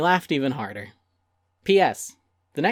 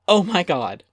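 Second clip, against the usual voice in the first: exasperated oh my god; 6 semitones higher, 8 dB louder than usual. Real, not acted. Reacting to own review.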